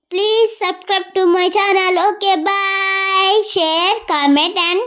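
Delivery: very slow, stretched sign-off; drawn-out outro. Real, not acted. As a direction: stretch every word of the goodbye. Kid's drawn-out channel outro.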